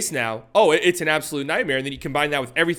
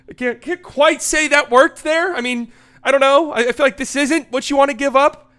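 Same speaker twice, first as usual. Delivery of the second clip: high, louder hedging; high-pitched doubt; 10 semitones higher, 5 dB louder than usual. Real, not acted. Commentator unsure the defensive tactic worked, high-pitched doubt. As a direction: squeak out a doubtful verdict, voice pitched well up.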